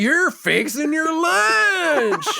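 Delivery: very high, slow Southern squeal; mock Southern accent. Host mimicking his co-host, in a squealed Southern accent. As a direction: imitate the twang way up high, stretching LUNCH.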